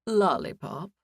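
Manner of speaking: puzzled, serious "Lollipop?" with a questioning lift; a straight-faced clerk checking the word "Lollipop?"; curious question. Acted. Clerk repeats the guest's odd name, curious and serious. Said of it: "he asked with a curious, serious expression".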